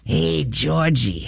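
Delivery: slow, eerie sewer-clown coo; creepy clown voice. Retold or imitated. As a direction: lure the kid in slowly and creepily. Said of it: "pops his head out of the sewer and goes".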